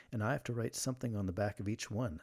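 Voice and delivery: ordinary talk, nothing marked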